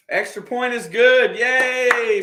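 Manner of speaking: high, loud happy cheer; excited cheer. Real, not acted. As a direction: cheer the kick going through. Cheering the extra point.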